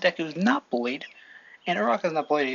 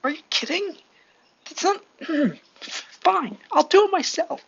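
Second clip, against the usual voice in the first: high, indignant outburst, building louder; acted exasperation; 13 semitones higher, 5 dB louder than usual. Deku refused help by the heroes, acted exasperated outburst. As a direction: protest high, then loudly take charge.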